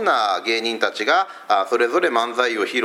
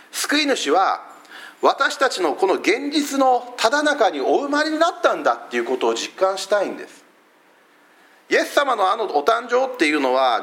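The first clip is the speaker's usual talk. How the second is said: higher, rising preaching with weighty pauses; earnest preaching; 10 semitones higher than usual. Acted. A pastor tells his congregation his Advent hope, preaching. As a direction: lift the congregation toward the message. Sermon climax.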